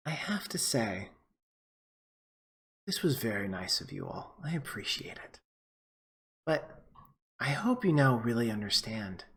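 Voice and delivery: quiet gloat over an outclassed party; vampire villain murmuring contempt. Acted.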